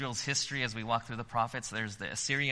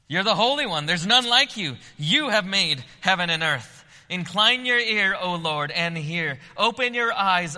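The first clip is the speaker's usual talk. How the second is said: much higher and louder, pleading to heaven; impassioned prayer; 7 semitones higher, 9 dB louder than usual. Acted. Hezekiah begging God to hear, impassioned prayer. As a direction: cry out to God with full force.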